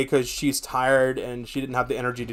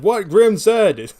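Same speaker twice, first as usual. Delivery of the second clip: high, loud, drawn-out repeat; mock declaiming; 7 semitones higher, 9 dB louder, much slower than usual. Repeating Grim's point to chat, mock declaiming. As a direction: stretch it out high and loud, mockingly grand.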